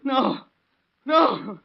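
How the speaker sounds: loud, high cry of no; anguished denial. Acted. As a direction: refuse to believe it. Father refusing the news.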